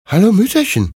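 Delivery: high, inquisitive princess voice; curious princess. A narrator's reading. Princess greets an old woman on the road, curious. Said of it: "fragte die Prinzessin neugierig".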